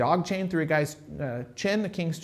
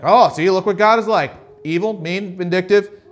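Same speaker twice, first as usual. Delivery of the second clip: mocking critics; 2 semitones higher, 8 dB louder than usual. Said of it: "people will be like".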